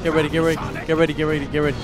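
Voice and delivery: ordinary talk, nothing marked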